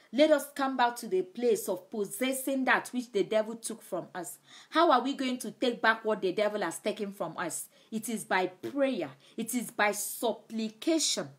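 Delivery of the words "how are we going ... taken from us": ordinary talk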